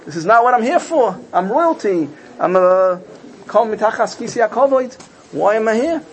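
mild mock cry for help, high-pitched; high, protesting plea without a real scream; pleading outcry. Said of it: "The neshama screams out".

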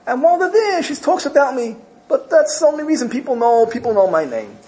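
humble disciple brushing off praise in a high voice; humble Naftali. Retold or imitated. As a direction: shrink yourself, high and self-effacing. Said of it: "He says".

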